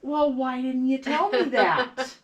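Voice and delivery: loud, high, drawn-out mock exasperation; exasperated outburst. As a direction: let retold frustration burst out slowly and loudly. Retold exasperation at the elder.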